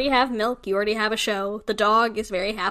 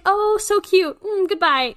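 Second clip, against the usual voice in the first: high, louder fake-polite chirp; mock fake sweetness; 9 semitones higher, 4 dB louder, slower than usual. Mom mimicking strangers dismissing her son, high and sugary. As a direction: brush the kid off sweetly.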